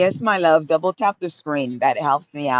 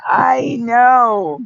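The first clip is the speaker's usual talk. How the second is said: mock wail; 8 semitones higher, 7 dB louder than usual. Fed up with winter.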